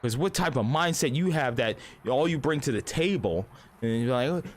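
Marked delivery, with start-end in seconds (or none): none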